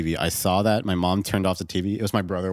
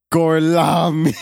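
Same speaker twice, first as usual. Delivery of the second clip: slow, louder hammed fake Italian "Gorlami"; bad Italian accent; 7 semitones higher, 8 dB louder, much slower than usual. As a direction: belt out "Gorlami" in mock Italian. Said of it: "Brad Pitt tries to speak Italian".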